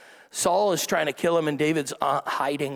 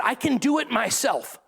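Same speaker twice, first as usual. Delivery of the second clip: imitating an offended woman, pitch jumping up; mock huffy, high-pitched protest; indignant retort; 7 semitones higher than usual. Lady at the mall refusing a held door, indignant.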